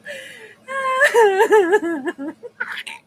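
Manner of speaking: high squealing laugh, dying away; helpless laughing fit. Real, not acted. Streamer cracking up at a viewer's comment, squealing laugh.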